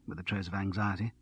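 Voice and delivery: ordinary delivery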